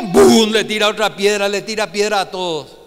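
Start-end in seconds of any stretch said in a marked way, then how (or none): 0.0-0.5 a sudden loud spoken boom; imitated stone thud. Preacher acting out stones thrown at David, loud thud sound. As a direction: slam the word out like a rock hitting.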